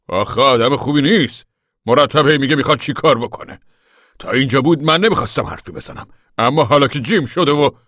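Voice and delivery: insistent. A narrator's reading. Pa keeps on criticising Connie, loudly insistent. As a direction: press the point loudly and high. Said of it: "پاپا مصرانه گفت".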